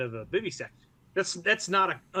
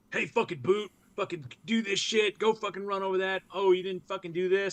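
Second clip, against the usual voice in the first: higher, barking mock NCO; harsh hazing; 5 semitones higher than usual. Senior Marine hazing his junior, barking mock orders. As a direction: bark orders at the boot, demeaning him. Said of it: "as opposed to, like".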